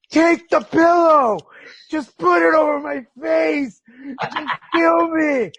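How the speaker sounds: high, loud, drawn-out tearful plea; serious but crying. Retold or imitated. Exhausted patient begging partner at bedtime, serious but crying. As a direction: re-enact a desperate sobbing wail. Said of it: "serious tone, but crying".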